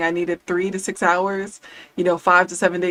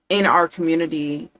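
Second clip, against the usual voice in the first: usual speaking voice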